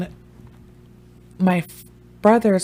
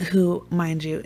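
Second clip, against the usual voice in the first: ordinary delivery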